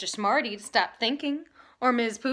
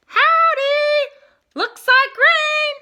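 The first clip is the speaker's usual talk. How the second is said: loud, high, drawn-out holler over singing; yelling; 12 dB louder, much slower than usual. Acted. The boy yells his greeting over the singing, loud and high. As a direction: shout it over the music. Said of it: "Holler yelled over the singing".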